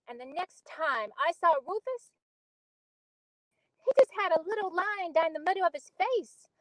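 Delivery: put-on earnest storyteller recalling a childhood accident; Beneatha monologue. Auditioning actor recalls Rufus's sledding accident, earnest dramatic monologue.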